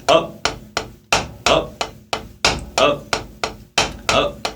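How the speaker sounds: one short word per stroke, long gaps; spaced, rhythmic cue on each beat; calling out strokes. Shown as a demonstration. Drum teacher calling each upstroke, spaced in time.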